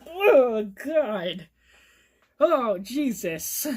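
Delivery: loud, groaning "oh" of revulsion; drawn-out appalled groan; disgusted horror. Retold or imitated. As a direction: reenact being deeply creeped out, dragging the words out. Reenacts creeped-out reaction.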